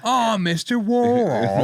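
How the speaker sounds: high, slow, drawn-out character greeting; mock character voice. Co-host joining the Warhol bit, high hammed-up voice. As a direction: stretch it out high, hamming it up. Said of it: "our own bit of [name] doing".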